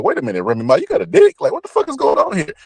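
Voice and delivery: high, incredulous mock outrage; shocked disbelief. Commenting on a [name] video, high incredulous disbelief. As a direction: react like you can't believe your eyes.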